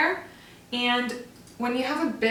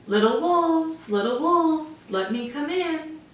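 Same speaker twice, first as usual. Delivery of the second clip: grunting pig voice; 6 semitones higher than usual. Acted. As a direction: coax the wolves like a sly pig.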